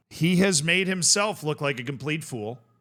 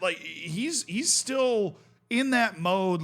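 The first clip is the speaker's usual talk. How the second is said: ordinary talk, nothing marked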